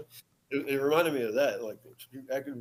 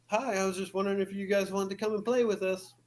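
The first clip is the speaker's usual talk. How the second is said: put-on higher, sweet little girl voice; girly voice; 7 semitones higher than usual. Man imitating strange girl at night, high girly voice. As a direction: mimic an innocent young girl. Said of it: "she goes".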